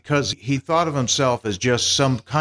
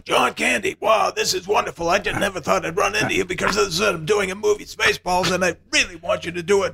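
much higher, excited, booming Mel Brooks voice; 8 semitones higher than usual. Retold or imitated. Mel Brooks greeting John Candy in the lobby, higher and excited. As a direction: gush like an overjoyed showman spotting a star. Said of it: "So he's going".

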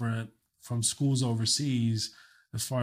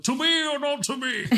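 hammed-up British Shakespeare accent, much higher; 3 dB louder than usual. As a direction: recite it like a grand British stage actor. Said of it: "doing this British accent".

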